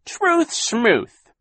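high, loud scoff on Truth schmooth; dismissive villain. A narrator's reading. Captain Sham scoffing at Klaus. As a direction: brush the truth aside.

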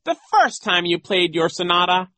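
high, loud character voice; flattering Genghis. A narrator's reading. Genghis praises Nero's sonata, high flattery. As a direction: gush it high and emphatically.

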